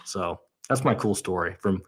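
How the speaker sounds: normal talking voice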